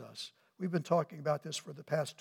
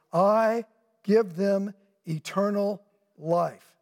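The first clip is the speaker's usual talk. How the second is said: slow, loud, every word weighted; solemn scripture quote; 3 semitones higher, 6 dB louder, much slower than usual. Acted. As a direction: proclaim it like the voice of Jesus himself. Quoting Jesus with preaching force.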